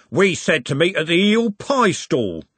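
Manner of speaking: slow, high-pitched reproach from a mate; confronting mate. Acted. Rough mate confronting Trev.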